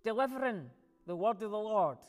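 loud punched phrases with weighty pauses; impassioned preaching. Real, not acted. Preacher picturing God's word delivered boldly, forceful preaching. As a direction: build the proclamation, landing hard on key words.

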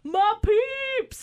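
high, loud happy call; joyful greeting. Retold or imitated. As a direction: call out to old friends with open arms. Nick greets them arms open.

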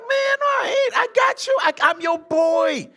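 high, loud, shouted protest; Peter's boastful protest. Retold or imitated. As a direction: shout it up high like a cocky friend. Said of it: "And Peter's like".

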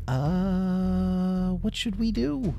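high, frightened dither with a drawn-out "uh"; scared. Acted. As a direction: sound terrified and helpless. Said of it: "he was shaking so hard because he was so scared".

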